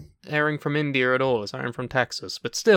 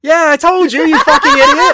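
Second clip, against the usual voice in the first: loud, high, laughing yell; triumphant shout; 12 semitones higher, 11 dB louder than usual. Real, not acted. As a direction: scream it in triumphant disbelief.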